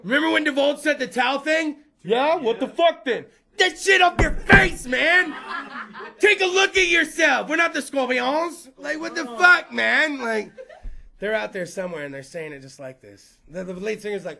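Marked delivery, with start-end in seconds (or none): 2.0-10.2 loud, high shouted tirade, slowed on the first order; mock furious rant. Comedian yelling at pretentious bands to take towels off. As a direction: bark orders at imaginary rock stars.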